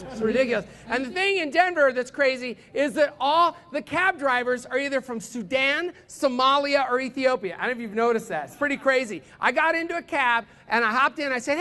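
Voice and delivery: ordinary talk, nothing marked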